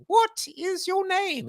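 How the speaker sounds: very high, slow sketch-comedy bridge keeper; hammed-up Monty Python gatekeeper; terrible bridge keeper accent. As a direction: draw it out in a high, silly put-on voice. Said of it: "in my terrible accent".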